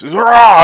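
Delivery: high, loud comic roar; imitated roar of a beaten man. Man hit with wood falls to the ground, loud roar.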